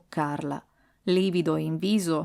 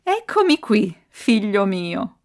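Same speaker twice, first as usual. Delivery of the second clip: diabolical smile; 3 semitones higher, 5 dB louder, slower than usual. A narrator's reading. Frightful figure appears before Ardo in the tomb, diabolical smile. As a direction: greet him with devilish relish, dragging the last words. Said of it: "sorridendo diabolicamente disse".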